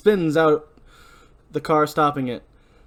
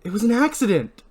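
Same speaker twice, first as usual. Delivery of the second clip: insistent protest; 9 semitones higher, 4 dB louder than usual. Acted. Julie protests.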